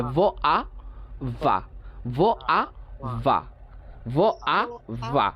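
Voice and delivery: each sound apart, slowly, then joined; broken into sounds. Shown as a demonstration. Teacher leading students to read in pieces, sound by sound. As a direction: sound it out bit by bit. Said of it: "ভেঙে ভেঙে পড়েন".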